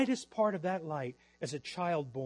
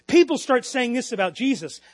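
normal talking voice

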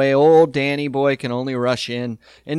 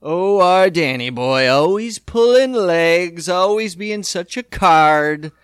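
slow, louder, doting parent voice; sweet doting parents; 4 dB louder, slower than usual. Retold or imitated. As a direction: gush slowly like proud parents. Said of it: "They're just like".

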